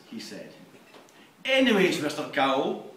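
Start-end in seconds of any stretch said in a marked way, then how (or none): 1.4-2.9 loud mocking echo. [name] repeats again.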